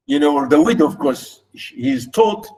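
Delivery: normal talking voice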